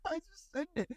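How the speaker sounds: high, soft words through laughter; real giggle, voice going high and quiet; laughing. Real, not acted. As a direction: squeeze the words out while laughing. Laughing at his own story.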